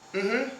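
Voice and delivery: rising yes hum, mm-hmm; agreeing mm-hmm. Shown as a demonstration. Hum "Mm-hmm" to a viewer as a yes. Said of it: "why do they sound different".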